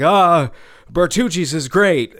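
high, drawn-out sales line; ad audition read. Retold or imitated. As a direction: belt out a slow, exaggerated commercial tagline. Acting out his ad audition take.

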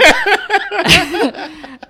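high, loud burst of laughter. Real, not acted. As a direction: crack up laughing. Laughs at the punchline.

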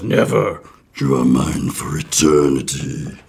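deep, slow godlike voice; booming planet god. Acted. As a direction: rumble it low and slow like a planet. Unicron's voice in the comic.

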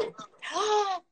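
sharp high teary gasp; emotional gasp. Real, not acted. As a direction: catch your breath, overcome. Sees Piggy, cries out.

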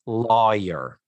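"lawyer" with a Texas twang, LAW-yer; Texas-style "lawyer", drawn out. Shown as a demonstration. Podcast host mimics an actress's Texas "lawyer" in a Texas accent. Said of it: "Texas accent alert".

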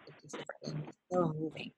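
whispering. Real, not acted. Softly describing the moving piece.

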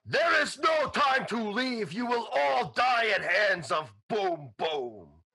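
slow, shouted barbarian challenge; Boom Boom war cry. Acted. The barbarian answering the voices behind the door, shouting slowly. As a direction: bellow it slowly, every word a threat. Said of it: "May I respond?".

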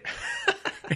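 a high, squeaky burst of laughter; high squealing laugh. Real, not acted. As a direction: crack up at your own story. Laughter after punchline.

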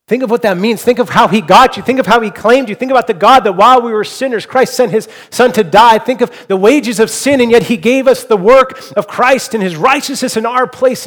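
fervent pulpit climax, rising and loud; preaching at full force. Acted. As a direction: pound the repeated call to think.

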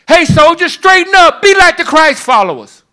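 very high, full-volume parade-ground yell; shouting military officer. Acted. Army officer ordering a soldier to straighten up, high hard shout. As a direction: order the soldier into line, screaming it. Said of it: "military come to us and say".

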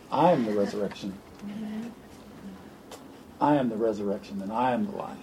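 low, quick, firm declaration; solemn Jesus. Acted. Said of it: "Jesus says".

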